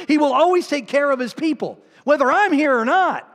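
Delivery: high, loud preacher's voice; fervent pulpit shouting; full-force preaching. Real, not acted. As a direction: drive it home to the congregation, loud and high.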